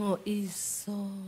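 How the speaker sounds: low, soft and slow; drawn-out gentle assurance; mild soft husband voice; soft Javanese husband. Retold or imitated.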